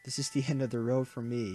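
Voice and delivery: usual speaking voice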